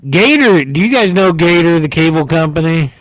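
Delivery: loud, high shout-out; calling out. Real, not acted. Host hailing Gator to the audience, loud high call-out.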